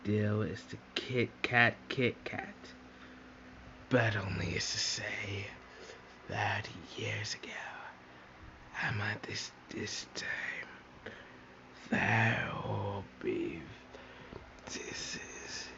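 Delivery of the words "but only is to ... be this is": unvoiced secretive whisper. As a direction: hush it like a secret. Whispered narration in toy play.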